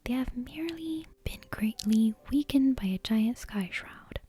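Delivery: plain reading voice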